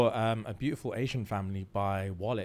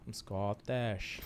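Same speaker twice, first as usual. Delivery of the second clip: soft, very slow Scottish accent; 2 semitones lower, 7 dB quieter, much slower than usual. Shown as a demonstration. Another try for friends, slow soft Scottish accent. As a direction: draw it out quietly in a Scottish accent. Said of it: "what can I say in Scottish?".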